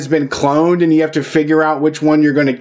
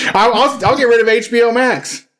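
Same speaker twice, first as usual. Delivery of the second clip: higher, pressing, earnest promise; eager vow; 4 semitones higher than usual. Acted. Vowing to give up HBO Max for dues, eager and higher. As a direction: swear it like a dare.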